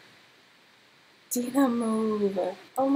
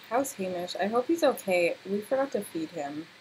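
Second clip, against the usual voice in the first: ordinary talk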